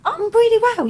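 very high squeaky reply; high squeak. Acted.

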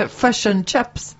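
slow Northern Irish-sounding Kiwi, "Fish" like fush; muddled Northern Irish-Kiwi accent. Retold or imitated. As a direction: mimic a Kiwi gone Northern Irish, "chips" like chups. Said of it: "why were you doing Northern Ireland accents then?".

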